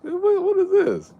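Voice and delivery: high squeaky surprised voice; mock shock. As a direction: squeal it, scandalized.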